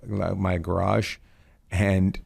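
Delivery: ordinary talk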